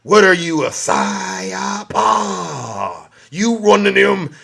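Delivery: drawn-out, slow whining complaint; mocking whiny shopper. Comedian mocking an anti-mask shopper, slow whine. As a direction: whine like a put-upon anti-masker.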